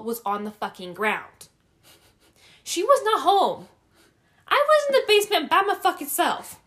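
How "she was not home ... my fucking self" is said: high, loud, indignant storytelling; worked-up, loud punchline of a spooky tale; emphatic outburst. Real, not acted. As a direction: hit every word, raised and high. Climax of her scary story.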